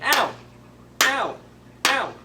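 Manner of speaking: high, loud ow with each chop; mock cries of pain. Fir tree being chopped down, crying ow high and loud. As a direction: yelp at every axe blow. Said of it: "he was saying".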